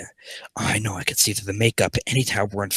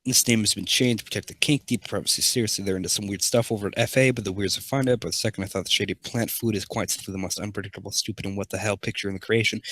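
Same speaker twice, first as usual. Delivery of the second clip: quiet story reading; 2 semitones lower, 6 dB quieter, faster than usual. Acted. Reads fan fiction quietly.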